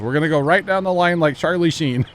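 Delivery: loud, high, showman delivery; moonshine guy voice. Retold or imitated. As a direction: announce it like a proud host. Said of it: "and he goes".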